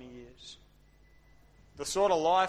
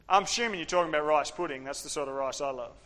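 normal talking voice

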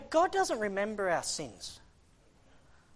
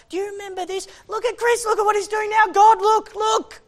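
high, loud, urgent finger-pointing; devil's accusation; 13 semitones higher, 9 dB louder than usual. Acted. Devil denouncing the preacher to God, high insistent accusation. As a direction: point him out loudly, pitched way up.

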